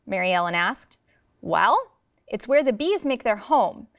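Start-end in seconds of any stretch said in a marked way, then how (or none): none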